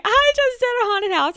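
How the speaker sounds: high-pitched gleeful bragging; proud announcement. Retold or imitated. Texting every contact after haunted house, proudly squealed. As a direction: squeal it with exaggerated pride. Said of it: "how proud I felt of myself".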